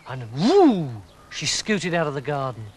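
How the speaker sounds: usual speaking voice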